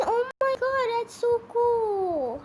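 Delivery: amazed. Real, not acted. Amazed at slime game turning green.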